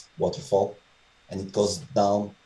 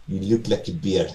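lightly imitated fake Russian accent; a friend teasing in a mock Russian accent. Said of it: "with that fake Russian accent".

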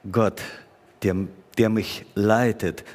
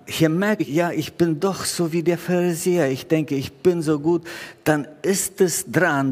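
raised, fervent sermon appeal; preaching; 5 semitones higher than usual. Acted. Pastor urging congregation to pray, fervent preaching. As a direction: urge the congregation to wake and pray.

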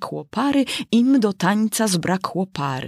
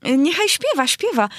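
eager outburst; 9 semitones higher, faster than usual. A narrator's reading. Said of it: "krzyknęła dziewczynka z taką namiętnością".

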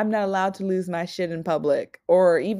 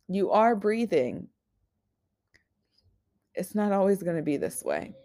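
tender reassurance; 3 semitones higher than usual. Retold or imitated. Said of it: "someone I love very much said to me once".

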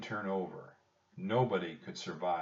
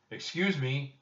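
higher-pitched polite call; mildly raised voice to get attention; 7 semitones higher than usual. A narrator's reading. Jack trying to get the busy rowers' attention, raised voice. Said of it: "said Jack in a loud voice".

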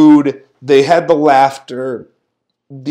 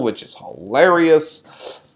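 usual speaking voice

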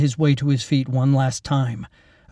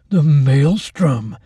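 slow, louder savouring gloat; 2 semitones higher, 5 dB louder, much slower than usual. A narrator's reading. Keith gloating as the storm hits, slow. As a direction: relish the name. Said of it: "Keith gloated in the dark".

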